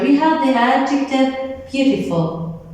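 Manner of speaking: ordinary delivery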